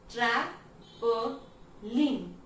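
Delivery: ट्रैपलिंग slowly split as tram-po-line; syllable by syllable. Shown as a demonstration. Teacher showing three completed sounds, syllable by syllable. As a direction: pause after each beat of ट्रैपलिंग. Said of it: "sound complete होना है".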